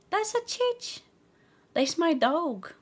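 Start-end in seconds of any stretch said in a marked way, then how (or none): 0.1-1.0 cutesy pet voice. Real, not acted. Owner cooing about her dog in a high voice. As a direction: coo it at the dog.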